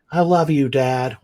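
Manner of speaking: a hint of a small daughter saying it; daughter's voice.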